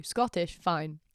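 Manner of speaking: normal talking voice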